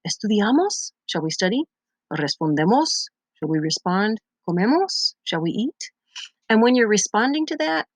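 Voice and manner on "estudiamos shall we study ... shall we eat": "Estudiamos?", "Respondemos?", "Comemos?" each rising at the end; question intonation. Shown as a demonstration. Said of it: "say it like you're asking a question".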